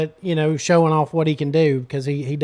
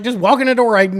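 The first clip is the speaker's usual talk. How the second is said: exasperated call; 6 semitones higher, 6 dB louder than usual. Retold or imitated. Telling guest to come in.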